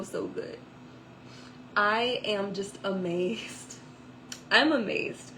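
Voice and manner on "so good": breathless whisper of awe; hushed reverent so good; awed whisper. Real, not acted. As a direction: whisper it, overwhelmed by God's goodness. Emphatic praise of God.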